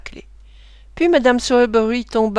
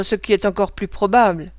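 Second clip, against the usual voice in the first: ordinary delivery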